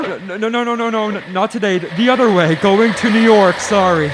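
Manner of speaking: loud, high, rushed string of nos; a frightened driver blurting excuses through the car window; panicked refusal. Acted. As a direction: stammer him off, scared and hurrying.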